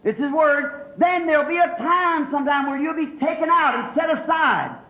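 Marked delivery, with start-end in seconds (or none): none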